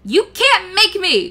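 high, loud crackling demon defiance. A narrator's reading. Calcifer defies Sophie with a loud crackle. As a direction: spit it back like a flame. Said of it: "crackled the fire".

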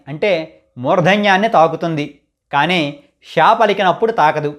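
ordinary delivery

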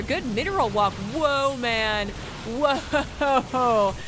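high, loud, drawn-out whoa; amazed reaction. Real, not acted. Caster watching a good mineral walk, amazed. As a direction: react in awe to a slick play.

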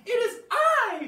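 very high, loud little dino voice; little Stanley voice. Acted. Baby triceratops answering the T-Rex, very high and brave. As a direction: pipe up bravely like a tiny triceratops. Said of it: "said Stanley".

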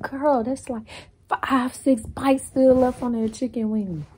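high, loud, incredulous comeback; exasperated retort. Retold or imitated. Arguing back at a friend over wasted wing meat, exasperated. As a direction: protest loudly, stretching out the count. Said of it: "but I'm like".

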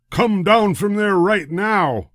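high, loud, slow holler. A narrator's reading. Trainmaster hollering up at George. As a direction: bellow it up at the monkey. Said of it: "he hollered at George".

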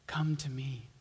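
soft whisper, gently inviting; whispered invitation. Acted. Preacher voicing Jesus to the weary, hushed whisper. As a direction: breathe it like a tender call.

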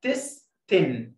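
"إن تستن" broken into slow, high syllables. Shown as a demonstration. Teacher breaking the word down for pupils, slow separated syllables. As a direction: stretch "إن تستن" apart, pause between syllables. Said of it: "ثلاث مقاطع".

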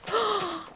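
high, overjoyed gasp; big happy intake of breath; delighted gasp. Real, not acted. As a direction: gasp in thrilled surprise. Overjoyed at finding a village.

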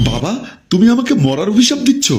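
high, hurt question to father; acted distraught appeal; shocked protest. Daughter reacts to father's curse.

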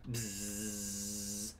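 quiet buzzing hum; soft bee buzz. Retold or imitated. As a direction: buzz like the bees. Imitating bees.

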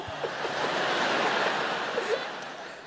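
long high-pitched unvoiced laugh; real helpless laughter; high squealing laugh. Real, not acted. Comedian in stitches over carbs, squealing laugh.

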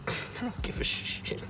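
breathy whispered aside; whispering. Real, not acted. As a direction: whisper it under your breath.

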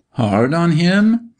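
high, drawn-out rising echo of disbelief; indignant interruption. A narrator's reading. Doctor interrupting Martha's plea, indignant echo. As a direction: throw it back slowly as a high question. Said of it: "the doctor interrupted".

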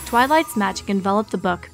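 ordinary delivery, nothing marked

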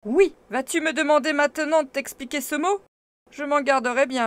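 loud, high sharp reply; irritated. A narrator's reading. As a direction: bite back with wounded pride. Heated exchange.

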